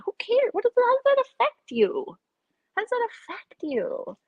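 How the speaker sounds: indignant outburst. Real, not acted. Defending the witch, shrill indignant outburst. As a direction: throw up your hands, shrill. Outraged rant peak.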